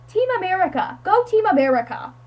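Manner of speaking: high cheerleader-style rallying call; mock cheer.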